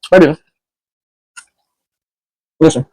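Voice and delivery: usual speaking voice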